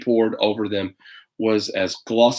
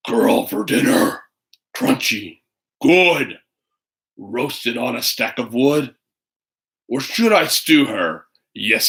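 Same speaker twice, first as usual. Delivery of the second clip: a hungry beast gloating over its dinner; hungry bear. Acted. Bear voice in story.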